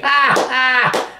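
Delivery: high-pitched ha-ha burst of laughter; sudden high cackle of amusement. Real, not acted. Amused by comment he reads.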